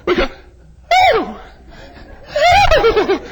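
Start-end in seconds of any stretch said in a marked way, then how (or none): 1.2-3.2 short mock-restrained "ooh"; a hammed-up polite little whoop; dignified shout. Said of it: "we do it dignified. We, we go".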